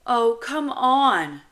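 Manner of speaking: exasperated big-brother plea; sighing exasperation. Acted. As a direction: sigh it out, long and loud. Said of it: "I sigh".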